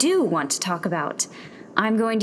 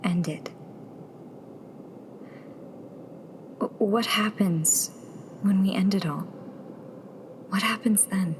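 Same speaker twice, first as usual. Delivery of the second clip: fearful whisper; 6 dB quieter than usual. Acted. Tessa whispers her fear to Nero about what comes after. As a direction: dread creeping in, barely breathed. Frightened question about ending.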